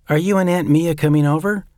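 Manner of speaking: put-on a small boy chatting to his uncle; little boy Jeffrey. Jeffrey keeps talking.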